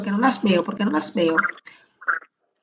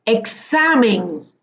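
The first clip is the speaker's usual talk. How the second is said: Examen drawn out, leaning on XA; slow stress demonstration; much slower than usual. Shown as a demonstration. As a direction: stretch Examen so the strong syllable XA shows. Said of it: "Examen con acento en la E". (Examen lento, marcando la sílaba xa)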